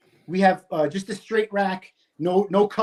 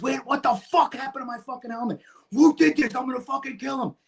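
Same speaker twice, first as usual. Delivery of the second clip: higher-pitched retold yell; screaming in anger; 5 semitones higher than usual. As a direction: act out screaming at the guys, voice pitched up. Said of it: "I'm screaming at these guys".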